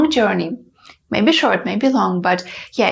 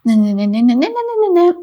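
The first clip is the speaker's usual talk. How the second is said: imitated nervous babble; 3 semitones higher, 3 dB louder, faster than usual. Shown as a demonstration. Acting out nervous hair touching, rising babble. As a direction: babble nonsense, sliding way up. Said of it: "I did like this".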